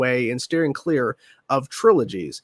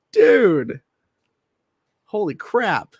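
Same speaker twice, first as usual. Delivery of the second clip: real amazement; 6 semitones higher, 4 dB louder, much slower than usual. Real, not acted. Still reeling from the gift.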